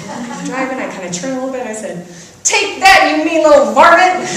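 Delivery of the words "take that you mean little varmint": loud and high, hammed-up gloating; playful mock taunt, half laughing; mock triumphant shout. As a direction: yell it at the possum like a cartoon hillbilly.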